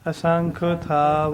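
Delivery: slow "ਅਸੰਖ ਥਾਵ" with a long "ਥਾਵ"; drawn-out, chant-like "ਅਸੰਖ ਥਾਵ"; measured recitation. Shown as a demonstration. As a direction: recite it deliberately, stretching "ਥਾਵ". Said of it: "ਇਸ ਤਰ੍ਹਾਂ".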